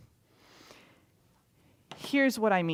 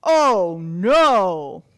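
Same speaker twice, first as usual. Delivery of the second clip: loud dismayed oh no; king hearing the news, loud alarm; shocked oh no; 2 semitones lower, 11 dB louder than usual. Acted. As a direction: gasp it out in dismay.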